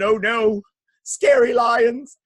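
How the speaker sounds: high, loud, stretched-out cry; mock frightened shout. A narrator's reading. Townspeople facing lions, high loud dismay. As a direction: shout in theatrical alarm.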